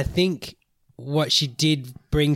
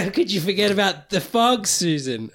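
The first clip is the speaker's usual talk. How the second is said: laughing exasperated scolding; 6 semitones higher, faster than usual. Real, not acted. Laughing rebuke of Susan.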